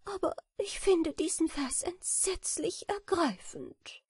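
high, sorrowful little voice; quiet and sad. A narrator's reading. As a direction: say it high and mournfully, deeply moved. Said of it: "sagte leise und traurig Notburger". (leise, traurig, mit hoher Stimme)

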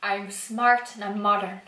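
lightly put-on Dublin accent; half-hearted Irish accent from Dublin. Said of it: "That's like a really Dublin accent".

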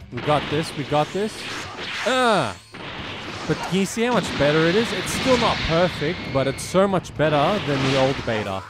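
normal talking voice

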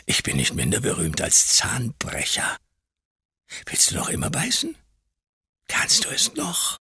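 menacing whisper. A narrator's reading. Creakle whispers, beating him.

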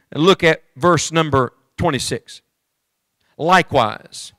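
ordinary talk

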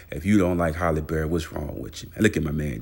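normal talking voice

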